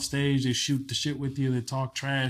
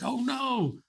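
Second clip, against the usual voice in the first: retold alarm; 11 semitones higher, 3 dB quieter than usual. Retold alarm of waking comedian.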